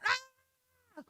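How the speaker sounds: high imitation baby wail; mock infant cry. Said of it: "with the baby crying".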